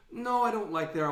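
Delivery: high-pitched mock refusal; objecting woman. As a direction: imitate a woman turning down the plan. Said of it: "she's gonna say".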